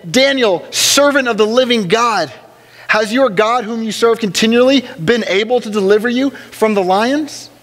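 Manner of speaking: the king calls into the den, worried; lamenting king. A narrator's reading. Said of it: "cried out with a lamenting voice".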